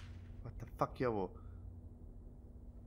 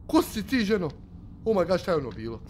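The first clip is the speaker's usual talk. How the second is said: normal talking voice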